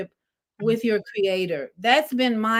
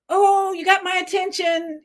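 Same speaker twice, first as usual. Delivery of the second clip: mock surprise; 8 semitones higher than usual. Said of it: "it's like".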